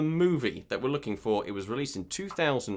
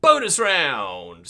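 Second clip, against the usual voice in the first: big, drawn-out announcer call; game-show announcement; 6 dB louder, much slower than usual. Real, not acted. Quizmaster unveiling the bonus round to players, showy announcer call. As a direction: stretch the words out like a show host.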